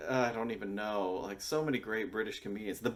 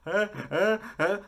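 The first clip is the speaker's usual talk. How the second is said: loud, high honking mock laugh; Jimmy Carr's laugh; 13 semitones higher, 9 dB louder than usual. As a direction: imitate an awful laugh in separate bursts. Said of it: "Jimmy Carr and his fucking laugh".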